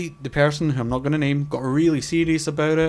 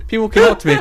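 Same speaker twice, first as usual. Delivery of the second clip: laughing; 7 semitones higher, 8 dB louder, faster than usual. Real, not acted. Laughing while telling it.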